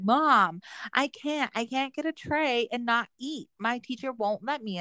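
high-pitched imitation of a complaining child; protesting daughter. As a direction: object to mom like an exasperated kid. Daughter protests mother's order, acted.

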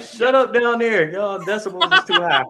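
loud, high, annoyed neighbor voice; dogs yelling upstairs. Retold or imitated. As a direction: yell it down the stairs. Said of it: "They like".

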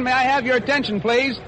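ordinary delivery

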